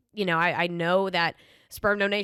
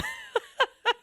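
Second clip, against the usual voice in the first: high burst of real laughter. Real, not acted. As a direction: crack up at your own bad luck. Laughing at her ringworm story.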